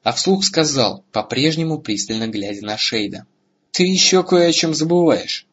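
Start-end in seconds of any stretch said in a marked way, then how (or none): 3.7-5.5 higher-pitched, pointed challenge; defiant warning. A narrator's reading. Hero challenging the Shade, defiant warning. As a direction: warn the foe with a lifted voice.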